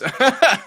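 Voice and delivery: a sudden, high, loud laugh; bright laugh breaking into the answer; loud burst of laughter. Real, not acted. Laughing at own brain's limits.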